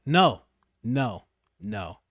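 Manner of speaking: ordinary delivery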